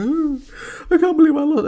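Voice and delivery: high-pitched, loud blubbering imitation; mock bawling. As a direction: wail it like a hammed-up sore loser. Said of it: "bawling and bub".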